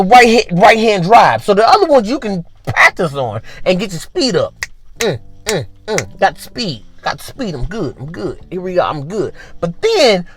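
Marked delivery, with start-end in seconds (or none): 5.0-9.3 soft, short phrases to oneself; self-satisfied focus. Acted. Worker who got speed up, quietly confident. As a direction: talk yourself along under the breath.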